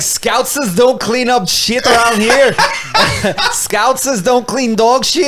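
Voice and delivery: laughing, slow mock Scouse accent; putting on a Liverpool accent through laughter. Mimicking the Liverpool homeowner in a slow Scouse accent. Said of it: "I can't do the accent".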